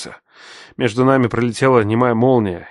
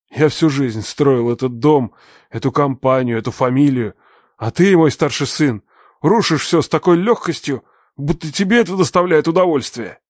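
bitter accusing father; 5 semitones higher, 3 dB louder than usual. Acted. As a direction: lay the blame on him.

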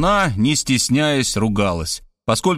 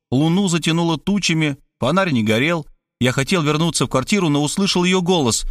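ordinary delivery, nothing marked

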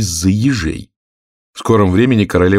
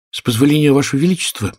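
higher-pitched, humble address; 6 semitones higher, faster than usual. A narrator's reading. Gardener on one knee humbly addresses the queen. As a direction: begin humbly, as a lowly subject. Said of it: "смиренно начал Двойка".

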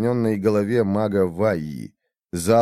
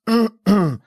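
rasping dry throat clear, like a parched rat; dry cough before speaking; 11 semitones higher, 4 dB louder than usual. A narrator's reading. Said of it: "Он опять откашлялся, сухо".

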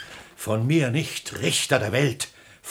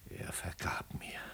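soft whispered relief; hushed whisper; broken whisper; 18 dB quieter than usual. Acted. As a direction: whisper it in shattered awe. Father, son forgave him.

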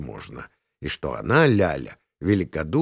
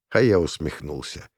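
usual speaking voice

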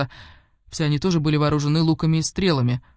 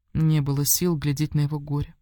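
plain reading voice

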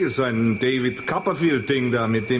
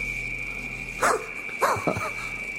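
astonished laugh; 7 dB quieter than usual. Acted. Reacts to the vanished bar.

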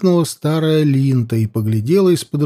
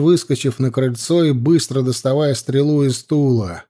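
plain reading voice

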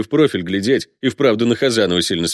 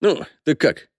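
ordinary delivery

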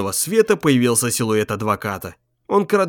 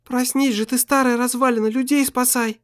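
high, scolding female voice; scolding woman's voice; 10 semitones higher than usual. Acted. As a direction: chide him awake. Wife's voice scolding.

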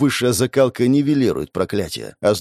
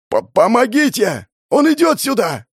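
high, terrified call for help; stammer, then scream in fear; panicked shouting, pitched far up; trembling-voiced cry; 13 semitones higher, 4 dB louder than usual. A narrator's reading. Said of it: "Дрожащим голосом кричал кто-то".

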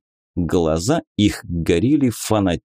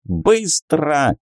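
sharp, higher snapped command; barked order; 6 semitones higher than usual. A narrator's reading. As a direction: bark it at him. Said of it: "рявкнул жрец".

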